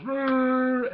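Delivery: loud, high rolling growl; Chewbacca. Retold or imitated. Retelling Chewbacca spotting bait, imitated Wookiee roar. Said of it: "Chewbacca goes".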